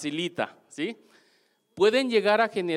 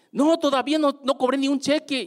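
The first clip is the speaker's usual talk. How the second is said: high, louder voiced lady admitting no check yet; lightly voiced upbeat lady; lady's reply; 9 semitones higher, 3 dB louder than usual. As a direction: play the cheerful woman with no pay. Lady's answer voiced higher.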